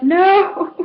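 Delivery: high, loud wail of no; hammed-up cry of dismay; mock despair. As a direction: wail it as everything falls. Despair as fixed aisle collapses.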